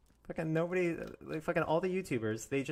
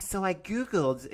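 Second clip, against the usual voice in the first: slow, higher put-on voice; mocking imitation; 7 semitones higher, slower than usual. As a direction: drag it out, parodying him. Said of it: "He's like".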